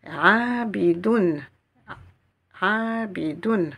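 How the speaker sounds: "Rabidoun" drawn out very slowly, doubled vowel closing on a clear "-doun"; Quranic recitation demo. Shown as a demonstration. Teacher to students, slow careful recitation of "Rabidoun". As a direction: spell out "Rabidoun" slowly, landing on the nasal "doun". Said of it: "Donc, on va prononcer don".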